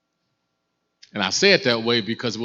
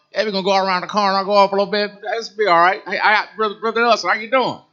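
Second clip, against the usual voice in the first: high, loud, fast imitation of an old-school talker; chatty old brother; 10 semitones higher, 8 dB louder, faster than usual. Imitating talkative brother on bus.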